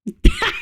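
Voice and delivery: sudden high squeal of a laugh; high burst of laughter. Real, not acted. Reacting to partner's burger habit.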